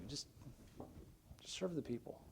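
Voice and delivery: hushed, whispered aside; whispered plea. Real, not acted. Preacher urges showy pastors to serve, whispering. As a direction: drop to a bare whisper.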